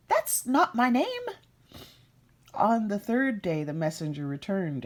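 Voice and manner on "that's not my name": high, loud squeaky retort; high impish cry; called out by the imp. Acted. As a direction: shout it back in a goblin voice. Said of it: "he always replied".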